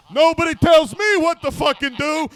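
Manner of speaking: imitating a brash comic's defiance; mock defiance. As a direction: puff up like a bully. Quoting Dice's defiance.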